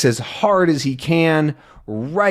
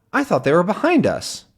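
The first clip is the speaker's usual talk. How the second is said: ordinary delivery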